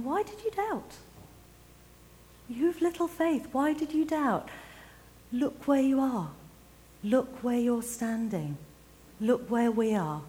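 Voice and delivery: Jesus gently teasing Peter with joy; Jesus speaking. Acted. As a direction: speak as Jesus, delighted and amused. Jesus voiced laughing, lifting Peter.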